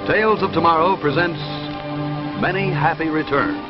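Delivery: normal talking voice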